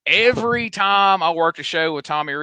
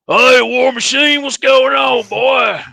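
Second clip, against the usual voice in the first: loud, high hollered greeting; calling out Tommy Rich; 9 semitones higher, 7 dB louder, slower than usual. Retold or imitated. Wrestler hollers a greeting at him across the tent, loud and high.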